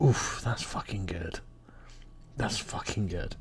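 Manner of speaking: near-whispered, savouring the joke; hushed awe. Retold or imitated. Comedy fan reacting to a daring punchline, hushed awe. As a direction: let it out under your breath, slowly.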